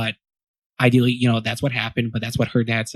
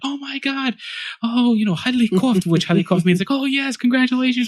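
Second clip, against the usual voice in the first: high, thrilled family cheer; excited cheering; 12 semitones higher than usual. Retold or imitated. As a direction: cheer like the whole family just leapt up. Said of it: "everyone jumps up like".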